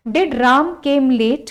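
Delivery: very slow, word by word; slow dictation. Shown as a demonstration. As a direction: spell it out for students to write. Teacher dictating a sentence.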